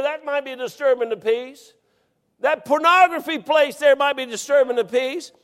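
high, loud incredulous retort; mock outrage at police; preaching story climax. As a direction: play the indignant exchange. Retelling argument, raised voice.

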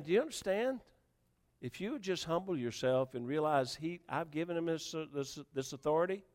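soft, subdued voice of God; hushed appeal. Acted. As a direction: bring it right down and reason with them. Preacher voices God gently after shouting.

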